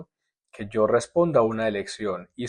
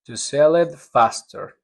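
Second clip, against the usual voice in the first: slow careful "To sell it faster"; slow model answer; slower than usual. Shown as a demonstration. Teacher modeling the answer for the class, slowly. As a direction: read it slowly for learners. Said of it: "modeled answer".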